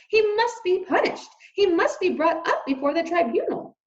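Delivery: high loud demand; shouting. Acted. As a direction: insist on punishment. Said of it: "shouted Lester".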